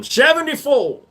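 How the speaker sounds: high, bright cry of Seventy-four; playful old-man exclaim; mock cheerful shout. Joking about age.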